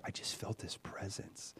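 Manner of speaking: breathy hushed whisper; whispered awe. Real, not acted. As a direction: whisper it like a secret. Awe at the presence.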